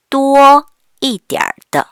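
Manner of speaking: teacher modelling slowly; slow model reading. Shown as a demonstration. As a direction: stretch each syllable for students. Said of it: "teacher models phrase slowly".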